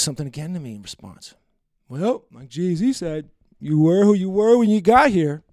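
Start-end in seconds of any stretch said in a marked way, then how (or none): 1.9-5.4 put-on slow, slurred drunk quote, getting louder at the end; drunk woman impression. Mocking the drunk woman he went home with. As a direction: drawl it out like a drunk girl quoting a lyric. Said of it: "That's exactly how she sounds ... that's my impersonation".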